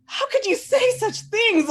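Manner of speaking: high, loud, slow shocked protest; mock outrage. Replaying her shock at the guru's blunt reply, in high mock outrage. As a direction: play up the young hurt shock. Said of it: "And I'm just like".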